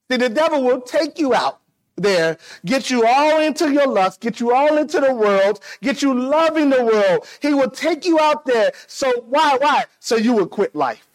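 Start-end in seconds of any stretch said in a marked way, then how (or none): 0.1-9.9 high, driving cadence in repeated phrases; impassioned preaching. Real, not acted. Preacher warning the congregation about the devil, urgent and high. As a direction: build a warning phrase upon phrase.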